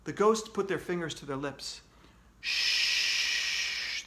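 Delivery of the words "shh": breathy, voiceless hush; whispered shush. Acted. Ghosts quieting the screaming kid, whispering. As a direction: hush him like ghosts in the dark. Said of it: "they whispered".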